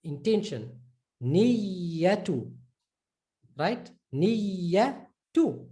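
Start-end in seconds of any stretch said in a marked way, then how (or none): 1.2-2.5 Niyatu with a long held iyy; stressed double y. Shown as a demonstration. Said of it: "just stress it a bit". 4.1-5.7 Niyatu with a long held iyy; stressed double y. Shown as a demonstration. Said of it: "you can see double Y".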